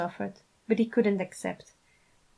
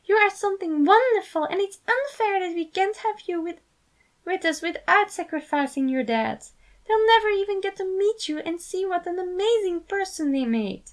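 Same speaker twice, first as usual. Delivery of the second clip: devastated cry; 11 semitones higher than usual. A narrator's reading. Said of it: "he cried devastated".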